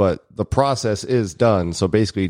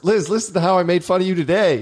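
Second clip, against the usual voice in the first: higher-pitched mock call to Liz; calling out to wife; 10 semitones higher than usual. Husband calling his wife to hear the podcast, playful high call. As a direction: playfully call her over to listen.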